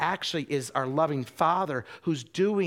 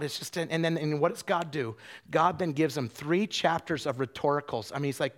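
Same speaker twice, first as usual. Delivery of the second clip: ordinary talk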